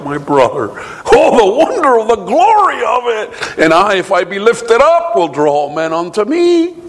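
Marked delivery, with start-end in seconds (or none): 1.1-6.7 high, ringing wonder; exultant preaching. Acted. Preacher marvelling at the cross, high and exultant. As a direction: lift your voice in awe.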